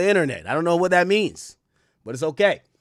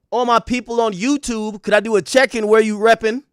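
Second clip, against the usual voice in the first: ordinary talk, nothing marked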